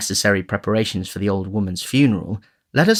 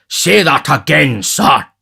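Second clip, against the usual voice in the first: slow, loud, threatening challenge; indignant official's booming dare; outraged beadle; 6 semitones higher, 7 dB louder, slower than usual. A narrator's reading. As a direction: thunder it slowly, voice raised. Bumble bursts in, outraged.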